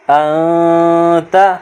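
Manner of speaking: ان تا with the نون hidden in a long nasal hum; ikhfa on ان. Shown as a demonstration. Teacher showing the ikhfa to students. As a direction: hide the نون of ان in the nose before تا. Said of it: "یہاں اخفا کریں گے".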